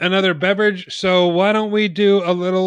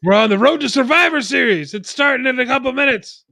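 announcer voice; 7 semitones higher than usual. Acted. Announcer bit.

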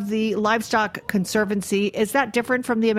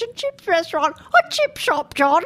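fruity mock British, pitched way up; fake British accent; 8 semitones higher, 3 dB louder than usual. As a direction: leap into a high put-on British voice. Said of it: "You're doing a very good accent there".

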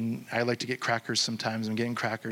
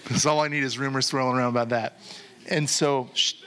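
ordinary talk

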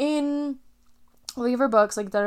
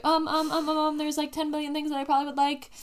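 flustered dithering; 6 semitones higher than usual. Retold or imitated. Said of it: "And I was like".

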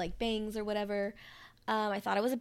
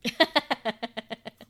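laugh; 7 dB louder than usual. Real, not acted. Laughing at camouflage bit.

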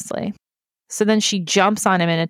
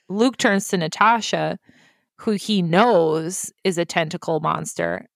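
normal talking voice

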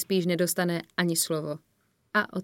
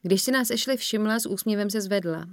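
plain reading voice

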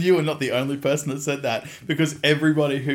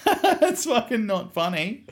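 high, drawn-out retold complaint; indignant protest; 13 semitones higher, 4 dB louder, slower than usual. Son to his laughing mum, comically indignant. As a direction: protest slowly, pitched way up.

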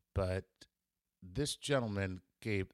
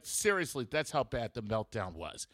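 usual speaking voice

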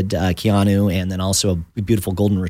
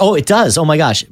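higher, louder gasp of discovery; real surprise; 6 semitones higher, 4 dB louder than usual. Real, not acted. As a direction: genuinely caught off guard by the clock. Host sees clock still counting.

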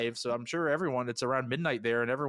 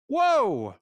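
excited whoa; 12 semitones higher, 8 dB louder than usual. Real, not acted. Reacting to a retort.

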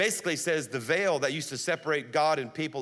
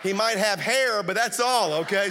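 loud, high laughing joke; laughing punchline; 5 semitones higher, 5 dB louder than usual. Real, not acted. Joke about pastor's baldness.

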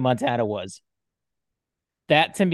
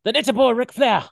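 much higher, fast, loud lisping promo voice; Dusty Rhodes impression; 12 semitones higher, 4 dB louder than usual. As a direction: rattle it off like a wrestling promo. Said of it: "I can kinda do a Dusty".

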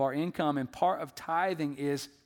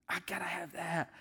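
whispered greedy wish; whispered craving; 9 dB quieter than usual. Retold or imitated. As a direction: whisper it like a secret want. Voicing greedy inner desire.

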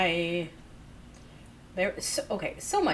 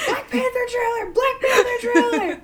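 high, loud fangirl shriek; excited squeal; 9 dB louder than usual. Retold or imitated. Imitating a friend's frantic trailer texts, excited squeal. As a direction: squeal it out at full pitch, laughing.

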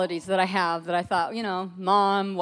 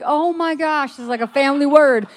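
amazed; 5 semitones higher, 8 dB louder than usual. Retold or imitated. Realising both gave same word.

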